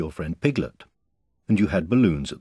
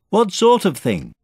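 high, loud question; calling up; 14 semitones higher, 8 dB louder than usual. A narrator's reading. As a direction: shout the question overhead. Boy calls up to Pooh.

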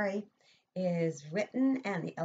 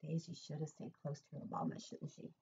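soft; 4 semitones lower, 14 dB quieter than usual. Real, not acted. Asking child softly.

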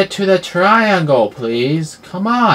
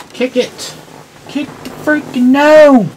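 excited yell; 11 semitones higher, 9 dB louder than usual. Real, not acted. Kicking the gnome.

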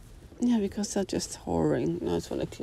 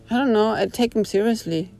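usual speaking voice

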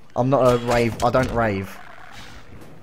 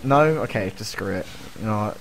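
usual speaking voice

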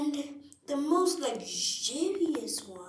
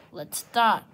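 ordinary delivery

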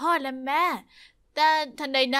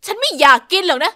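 much louder furious shout; angry snap; 9 dB louder than usual. Acted. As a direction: bark at her to take it away. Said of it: "เธอตวาดใส่ฉัน".

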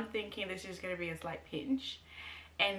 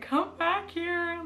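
high, drawn-out call back; urgently calling him back, stretched out; 12 semitones higher, 7 dB louder, much slower than usual. Retold or imitated. New mom calls her partner back, high and drawn out.

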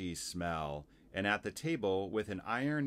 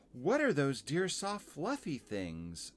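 put-on higher, curious little-girl voice; Lucy; 6 semitones higher than usual. Girl admiring woolly coats, high and curious. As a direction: ask high, like an admiring child. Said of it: "said Lucy".

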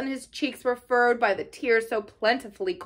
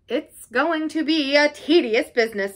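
higher-pitched, put-upon complaint; sighing; 5 semitones higher, 4 dB louder than usual. A narrator's reading. Badger conferring on Toad's confinement, sighing. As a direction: lament the long task ahead.

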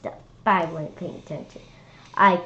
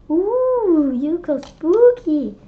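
high, loud, slow ooh; mock spooky; 9 semitones higher, 8 dB louder, much slower than usual. A narrator's reading. Mom teasing the kids in a spooky voice, high and loud. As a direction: drawl it out like a ghost.

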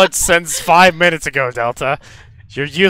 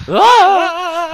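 put-on high child yelp; 6 dB louder than usual. Child character cries out.

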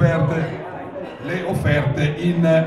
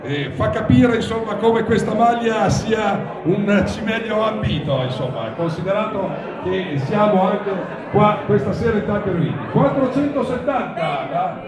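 raised, ringing bid calls; auctioneer calling bids; 7 semitones higher than usual. Real, not acted. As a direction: call out each bid to the room.

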